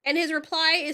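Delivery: ordinary talk, nothing marked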